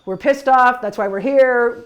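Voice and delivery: loud rallying reassurance to an angry friend; firm and loud. Retold or imitated. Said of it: "I'm like".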